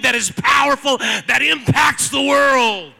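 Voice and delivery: loud, rising rallying sermon shout; full-force preaching. Real, not acted. Preacher urging congregation to find an Elizabeth, full-force preaching.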